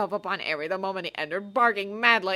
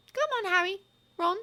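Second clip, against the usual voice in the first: put-on high young girl's voice, urgent; a high girlish push to leave; Hermione, eyes flashing. A narrator's reading. Hermione urging the boys away, high and urgent. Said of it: "Hermione's eyes flashed".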